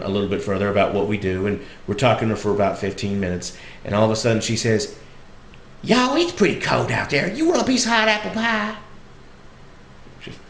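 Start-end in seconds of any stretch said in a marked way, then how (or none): 5.8-8.8 high Southern granny offering food; Southern old lady. Retold or imitated. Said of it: "sweet little old lady".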